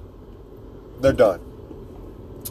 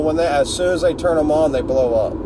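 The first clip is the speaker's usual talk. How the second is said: ordinary delivery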